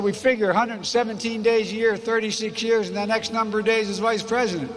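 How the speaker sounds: hammed-up conductor teasing his famous passenger; conductor's voice. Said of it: "he said".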